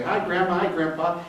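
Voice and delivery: a hint of a sugary visiting grandkid; sweet grandkid. Senator mimics a grandchild greeting grandparents, high and sweet.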